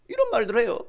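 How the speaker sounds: ordinary talk, nothing marked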